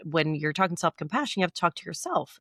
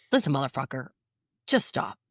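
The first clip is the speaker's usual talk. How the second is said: mock tough self-talk; 5 semitones lower than usual. Shown as a demonstration. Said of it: "It sounds mean".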